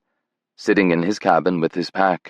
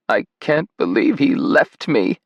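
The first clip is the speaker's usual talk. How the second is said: choked out; 7 semitones higher than usual. A narrator's reading. Grandmother to Simon, choking on grief. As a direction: voice catching, high and tight. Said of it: "she choked out".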